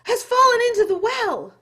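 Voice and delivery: high gasped fragments, then a loud burst; gasping. Acted. Chang breathless to his mother, gasping. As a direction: blurt it out on the last of your breath. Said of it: "he gasped".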